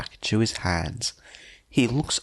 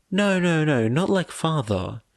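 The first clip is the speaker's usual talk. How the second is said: hurried denial; 6 semitones higher than usual. Acted. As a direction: cut him off before he finishes. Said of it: "she says immediately".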